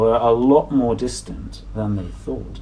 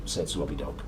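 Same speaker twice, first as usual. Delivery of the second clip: ordinary delivery, nothing marked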